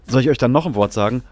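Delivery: ordinary talk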